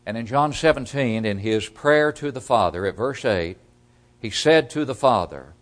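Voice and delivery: ordinary talk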